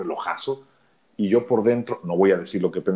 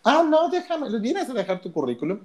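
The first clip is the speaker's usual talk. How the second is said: higher, light voice of the office receptionist; 13 semitones higher than usual. Retold or imitated. Receptionist tells the job seeker to leave his résumé, higher voice. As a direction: play the bored receptionist taking his résumé. Secretary voiced in a retold story.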